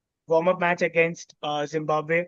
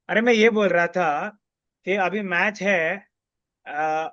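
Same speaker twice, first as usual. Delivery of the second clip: ordinary delivery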